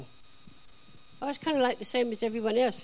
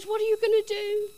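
much higher and louder, rattled; panicked high plea; 13 semitones higher, 7 dB louder than usual. Retold or imitated. Caught boss asking what she'll do, panicked and loud. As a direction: ask it like your job hangs on the answer.

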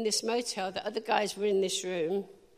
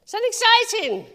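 much higher and louder rousing call to the crowd; excited call; 12 semitones higher, 11 dB louder than usual. Real, not acted. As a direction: lift the room with it.

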